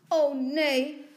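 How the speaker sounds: dismayed mother. A narrator's reading. Mother sees what Lotje has done, dismayed. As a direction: exclaim in alarm at the damage. Mother discovers the cut nightgown.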